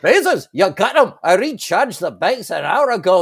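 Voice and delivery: excited engineer impression, high and loud; hammed-up, bouncy film-character voice; Scotty. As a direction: pipe up eagerly like a starship engineer. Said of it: "Scotty, who is my all-time favorite".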